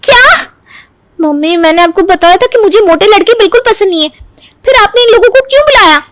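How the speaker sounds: high-pitched shocked outburst to her mother; appalled protest. Acted. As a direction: start with a sharp, high yelp, keep complaining high. Woman appalled at unwanted suitor.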